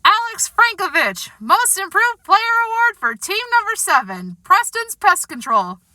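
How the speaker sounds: high, projected award-ceremony announcement; microphone announcer. A narrator's reading. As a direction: call out the winner to a whole hall. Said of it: "being called over the microphone".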